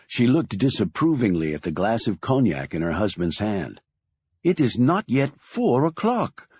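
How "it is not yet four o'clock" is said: higher, scolding mother. A narrator's reading. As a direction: scold him for drinking early. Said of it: "she said in a scolding tone".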